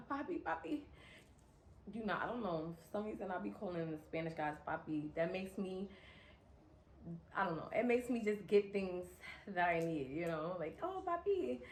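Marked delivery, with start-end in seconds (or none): 0.1-0.8 high, soft coaxing Papi; butter him up lightly; sweet-talking. Retold or imitated. Sweet-talking the car shop guy, high and soft. 10.8-11.6 high, soft coaxing Papi; butter him up lightly; sweet-talking. Retold or imitated. Sweet-talking the car shop guy, high and soft.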